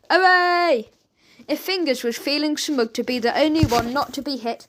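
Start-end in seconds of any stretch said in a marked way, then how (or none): 0.1-0.9 cheering. A narrator's reading. Court cheers.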